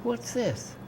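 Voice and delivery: high-pitched, softly puzzled reaction; quiet, high mock surprise; surprised double take. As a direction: retell a startled double take. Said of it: "he did a double take".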